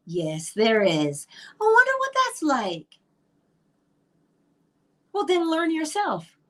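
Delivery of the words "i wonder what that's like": much higher, airy voice of a longing listener; dreamy wondering. Pretend. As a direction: wonder aloud as if it is far out of reach. Imagined listener longing aloud.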